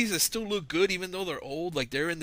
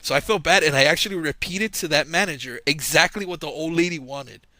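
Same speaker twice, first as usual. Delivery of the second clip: ordinary delivery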